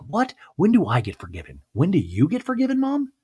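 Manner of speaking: high, incredulous pitch; mock outraged disbelief. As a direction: squeak the question in disbelief. [name]'s outraged reply.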